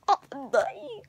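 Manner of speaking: hammed-up dying wail with a laughing cry; mock dying. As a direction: fake a dramatic death, half laughing. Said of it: "But if I was, it'd be like".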